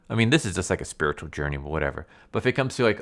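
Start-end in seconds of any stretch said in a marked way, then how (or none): none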